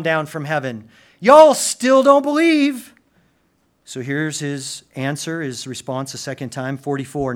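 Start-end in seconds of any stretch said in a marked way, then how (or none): 1.2-2.9 loud, slow, ringing rebuke; slow preacher's shout for unbelievers; rebuking shout. Acted. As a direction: hurl the rebuke at the crowd.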